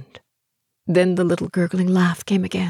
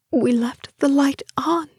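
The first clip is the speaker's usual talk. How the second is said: high-pitched, frightened thought; frightened inner voice; 11 semitones higher than usual. A narrator's reading. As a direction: tell yourself in high-pitched fear. Terrified inner voice in darkness.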